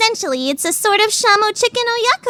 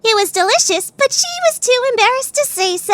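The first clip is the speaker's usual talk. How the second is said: ordinary talk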